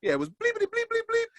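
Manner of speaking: fast high censor-bleep imitation; mock bleeps. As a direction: chirp it like a censor beep.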